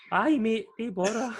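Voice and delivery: high, loud mock-confident yes; bravado. To the mate, cocky high mock bravado. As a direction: puff up and boast it to the mate. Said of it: "I was like".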